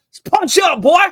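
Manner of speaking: pumped rallying cry; hype shout. Real, not acted. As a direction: fire up the crowd. Hyping fans against Henley.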